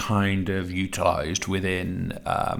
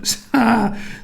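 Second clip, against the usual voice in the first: embarrassed laugh; 14 semitones higher than usual. Real, not acted. Tongue-tied teacher laughs at herself.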